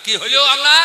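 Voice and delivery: the officer startled by the resignation; surprised. Retold or imitated. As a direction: ask in alarm.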